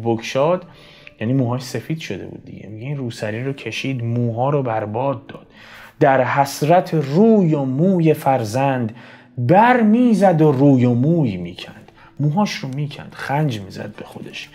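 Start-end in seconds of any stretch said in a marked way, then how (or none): 6.0-11.9 lifted, declaimed mourning verse; poem recitation. Acted. Teacher recites a mother's grief for her child, declaimed poem. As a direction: recite the lament in measured verse.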